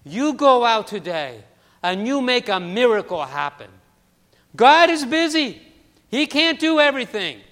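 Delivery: put-on loud, high, accented old woman's urging; the old neighbor blessing the child, loud and high; Mrs. Goldberg's accent. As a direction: push the child out the door with fervent love. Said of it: "that accent".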